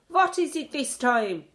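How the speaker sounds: high, loud, irritated shout. A narrator's reading. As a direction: snap out in annoyance. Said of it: "shouted the Grand High Witch".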